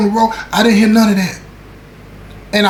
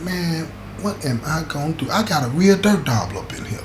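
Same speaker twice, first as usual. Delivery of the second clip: softer, muttering to himself; muttered inner thought; 8 dB quieter than usual. Retold or imitated. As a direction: mutter it under your breath like a private thought. Inner thoughts while fuming.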